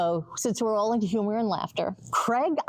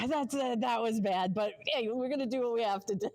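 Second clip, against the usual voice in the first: normal talking voice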